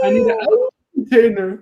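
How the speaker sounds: squeaky high voice; high falsetto. Pretend. Goofing in a high voice.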